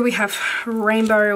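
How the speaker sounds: usual speaking voice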